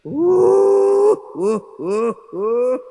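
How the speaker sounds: a high, broken imitation wail; mock hysterical sobbing. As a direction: ham up a widow's sobbing gasps. Imitating a widow's hysterical wailing.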